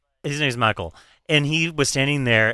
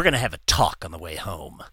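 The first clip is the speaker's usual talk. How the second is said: stern; 8 semitones lower, 5 dB quieter than usual. Retold or imitated. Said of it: "he looks at me and he goes".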